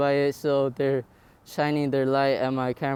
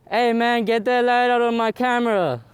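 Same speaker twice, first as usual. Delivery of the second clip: sharp, raised call at the cop; annoyed shout; 6 semitones higher, 4 dB louder than usual. Real, not acted. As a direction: snap at him to drop the light. Yelling at officer's flashlight.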